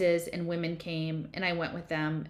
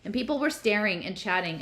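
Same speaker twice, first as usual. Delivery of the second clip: plain reading voice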